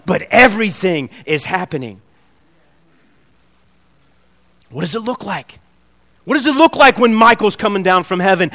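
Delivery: loud, high, driving sermon climax; preaching at full force. Acted. Preacher stirring the church with the angel battle, loud and high. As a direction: build the battle scene for the congregation.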